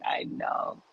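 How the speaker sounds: unvoiced whisper. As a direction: whisper it back. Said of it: "shout whisper".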